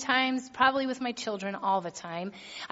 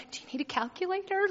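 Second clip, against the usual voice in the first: sarcastic jab; 7 semitones higher, 4 dB quieter, faster than usual. Retold or imitated. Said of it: "I'm like".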